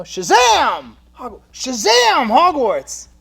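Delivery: loud, slow shout; magic incantation. Pretend. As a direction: boom it like a spell. Magic spell.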